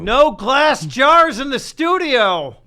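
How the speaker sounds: loud, slow mock reprimand; mock scolding. Host telling guest off about glass jars, mock scolding. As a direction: call out the rule like an indignant official.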